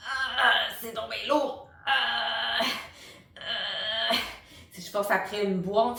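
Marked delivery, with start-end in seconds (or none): 0.1-4.2 slow effortful creaky ah; lifting something heavy; straining grunt. Shown as a demonstration. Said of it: "si je force après quelque chose qui est lourd". (grognement d'effort en fry)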